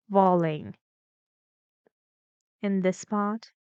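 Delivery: ordinary delivery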